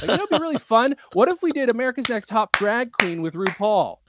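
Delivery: high, bright pitch voice; excited pitch. Retold or imitated. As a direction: sell the idea eagerly. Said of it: "Saying this out loud in front of a group".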